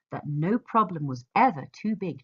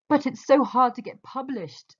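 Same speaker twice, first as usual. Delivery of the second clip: higher and louder, voicing her fear; a raised, worried inner voice that warns; fear of failure; 6 semitones higher, 5 dB louder than usual. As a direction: let fear of failure plead, anxious and raised. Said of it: "said fear of failure".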